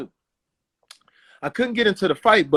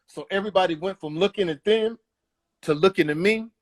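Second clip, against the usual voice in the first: ordinary delivery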